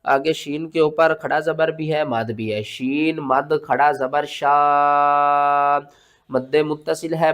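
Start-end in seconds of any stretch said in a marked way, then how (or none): 2.6-5.9 शा held very long; slow spelling, drawing शा out to five counts; stretched madd. Shown as a demonstration. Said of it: "खींच कर पढ़ेंगे". (शा को खूब खींच कर)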